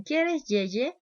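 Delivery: ¿Quieres yeye? alto, agudo y fuerte; voz de bebé. Retold or imitated. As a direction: imita al adulto que habla como bebé. Adulto usa lenguaje de bebé. ("¿Quieres yeye?" aniñado y agudo)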